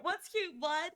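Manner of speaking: ordinary talk, nothing marked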